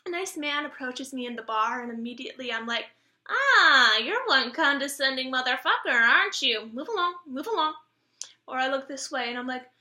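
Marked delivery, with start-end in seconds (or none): 3.3-7.7 loud, swooping brush-off; mock dismissal. Turning down a man in the bar, loudly scornful. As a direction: open on a big "ah", then wave them off.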